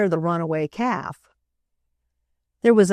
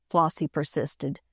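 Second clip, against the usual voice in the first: plain reading voice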